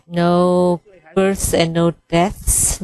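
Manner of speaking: normal talking voice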